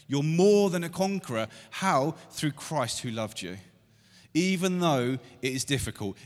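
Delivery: loud, high, driving sermon climax; rising fervour, pulpit at full volume; full-force preaching. Acted. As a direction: preach it out to the whole room.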